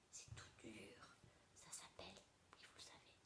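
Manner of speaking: confidence à voix basse; chuchoté. Shown as a demonstration. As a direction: murmure plein de suspense. Maîtresse baisse la voix, suspense. (chuchoté avec suspense)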